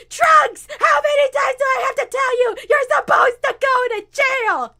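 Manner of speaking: high, loud exasperated scolding; angry kid yelling. Acted. Valise fighting Trunks over a board game, angry yell. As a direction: shout down a cheating playmate. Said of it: "getting mad".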